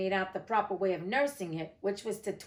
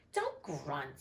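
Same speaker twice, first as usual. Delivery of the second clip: soft scolding; 7 semitones lower, 8 dB quieter than usual. A narrator's reading. Alice scolds baby quietly.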